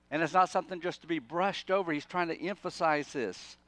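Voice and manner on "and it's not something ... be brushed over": ordinary talk, nothing marked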